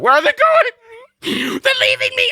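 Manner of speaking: very high, loud, frantic squeal; panicking dog voice. Retold or imitated. Dog Matilda panicking as her owners leave, squealing very high and loud. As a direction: wail it like an abandoned puppy. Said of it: "my impressions of Reagan and Matilda".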